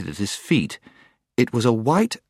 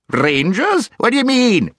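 high, loud, indignant query; deaf dwarf; 6 dB louder than usual. A narrator's reading. Deaf dwarf mishearing the owl, loud and indignant. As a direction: bark it back like someone hard of hearing.

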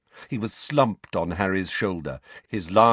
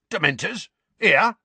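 higher, rising disbelief; aghast; 11 semitones higher, 5 dB louder than usual. A narrator's reading. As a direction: question it with mounting shock.

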